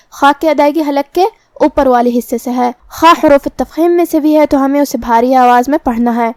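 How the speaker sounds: ordinary delivery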